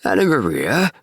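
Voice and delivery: high, sharp rat squeak rising to a shriek. Acted. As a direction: call her like a squeaking old rat. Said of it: "squeaked the rat".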